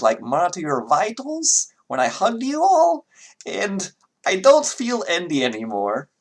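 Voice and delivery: high-pitched character voice, slightly halting; Vera. Acted. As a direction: play a concerned telepathic caller, pitched well up. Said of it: "that's the wrong accent for Vera".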